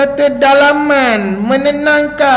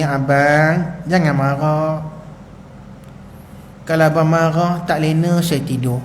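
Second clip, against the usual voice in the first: lower, softer tender plea; soft pleading wife; 6 semitones lower, 6 dB quieter than usual. Acted. Wife holding husband's hand, soft plea. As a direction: coax him gently out of anger.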